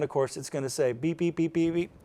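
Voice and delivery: usual speaking voice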